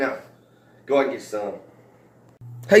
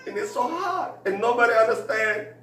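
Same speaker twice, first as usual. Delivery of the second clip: put-on much higher, whiny Mexican accent; hammed-up drunk self-pity; mock sobbing accent; 9 semitones higher than usual. Drunk boss complains nobody understands, in a high mock Mexican accent. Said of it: "and he's crying".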